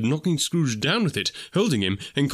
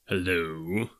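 lower, put-on grumpy old boss; a pretended grouchy growl, lower and softer; feigned growl; 6 semitones lower, 5 dB quieter than usual. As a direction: fake his old gruffness. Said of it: "growled Scrooge, in his accustomed voice, as near he could feign it".

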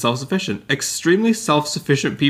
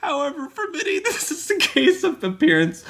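reading while fighting back giggles; giggly, high, breaking into laughter. Real, not acted. Reading aloud through giggles.

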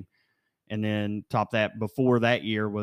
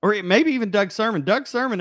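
worked-up naming; 9 semitones higher, 7 dB louder than usual. Real, not acted. Naming a disliked referee.

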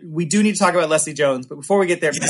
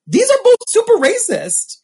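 very high incredulous squeal; high-pitched retold outrage; appalled protest; 4 dB louder than usual. Retells own protest to laughing friends.

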